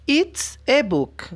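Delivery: "a" in "It's a book" said as a Spanish E; article pronounced E. Shown as a demonstration. Teacher showing students the article in "It's a book", "a" pronounced as E. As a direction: say "It's a book" slowly, the "a" sounding like "e". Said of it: "se pronuncia como una E".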